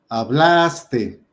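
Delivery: hablaste drawn out slowly on BLAS; stress on BLAS. Shown as a demonstration. Teacher giving an example of palabras graves, stress on BLAS. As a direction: stretch and lean on BLAS in hablaste. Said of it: "penúltima sílaba".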